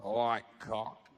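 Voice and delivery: low, slow, laughing mumble; mock sheepish low confession; sheepish admission. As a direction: admit it in a low embarrassed drawl.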